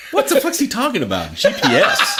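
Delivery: high, laughing mock of a clueless doubter; mocking baffled voice. Scoffing at the GPS idea, laughing high mock voice.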